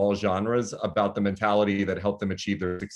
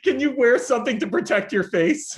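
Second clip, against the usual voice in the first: worried mom; 12 semitones higher than usual. Retold or imitated. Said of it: "You're like".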